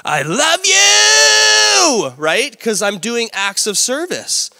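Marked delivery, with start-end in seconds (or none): 0.0-2.1 drawn-out shout, very high and much louder; husband yelling his love through chores; screamed declaration. Retold or imitated. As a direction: bellow it slowly at the top of your lungs. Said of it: "I'm screaming at the top of my lungs".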